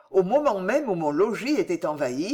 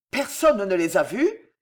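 ordinary delivery

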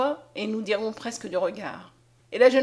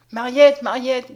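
trembling, much louder call of her name; shuddering call; 10 dB louder, slower than usual. A narrator's reading. Conscience to Mariette as his sight returns, shaken call. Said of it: "tout frissonnant".

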